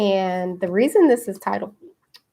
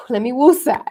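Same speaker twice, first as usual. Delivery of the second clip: calming breath cue; 4 semitones higher, 7 dB louder than usual. Retold or imitated. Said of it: "So I was like".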